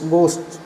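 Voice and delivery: गोस्ट said as "go" plus a closing consonant cluster; pronunciation demo. Shown as a demonstration. Teacher building the word from go plus SH, demonstrating गोस्ट. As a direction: model गोस्ट, opening with a plain "go" sound. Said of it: "गो plus एस एच".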